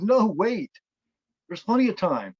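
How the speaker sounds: put-on high, loud pleading as the kid; imitated freak-out. Boy begs the woman to wait, mock freaking out. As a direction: stall her in mock panic. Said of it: "he freaks out".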